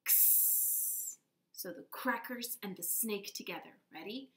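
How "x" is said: an unvoiced "X" like ks, hissing; ks sound. Shown as a demonstration. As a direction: crackle then hiss like a snake.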